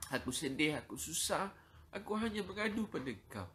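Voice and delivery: anguished pleading prayer. Shown as a demonstration. Preacher leads the congregation in a pleading, tearful prayer to God. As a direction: cry out to God in distress, again and again. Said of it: "Kalau tuan-tuan rasa sedih, susah, cakap".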